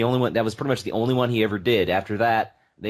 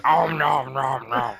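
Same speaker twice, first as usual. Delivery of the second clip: slow gobbling monster noise; munch it like Cookie Monster; 3 semitones higher, slower than usual. Retold or imitated. Cookie Monster eating sound.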